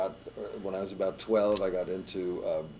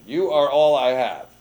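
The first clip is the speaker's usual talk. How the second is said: loud, higher, emphatic quoted line; retold nurse's blunt reply; 8 semitones higher, 16 dB louder than usual. Nurse telling a new doctor there is no one else, loud and emphatic. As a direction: hit it hard, like a nurse with no help to give. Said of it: "She said".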